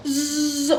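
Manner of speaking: high buzzing zip sound; imitated zooming noise. Showing herself sliding before her uncle hit, buzzing zoom. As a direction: buzz like something whizzing along.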